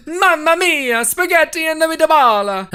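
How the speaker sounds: high, loud, slow bad Italian accent; mock Italian accent. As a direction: ham up a cartoon Italian.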